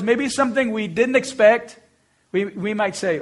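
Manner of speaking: normal talking voice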